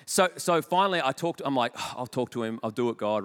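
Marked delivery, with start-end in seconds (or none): 1.8-3.2 reluctant grumble. Retold or imitated. Reluctant giving in.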